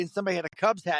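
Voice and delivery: ordinary delivery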